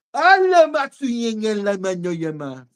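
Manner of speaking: slow, drawn-out tongues; speaking in tongues. Retold or imitated. Preacher voicing his son speaking in tongues, slow. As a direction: chant the strange syllables slowly. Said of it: "Arthur fez assim".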